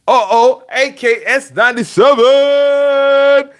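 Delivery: high, loud hype shout-out; big excited shout-out; excited greeting. Real, not acted. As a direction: hype up the viewer. Excited greeting of chat member.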